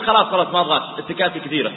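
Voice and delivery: loud, brusque refusal; mock curt dismissal. Shown as a demonstration. Preacher acting a rude rider leaving the taxi, loud and dismissive. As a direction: slam the door on him. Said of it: "وقلت".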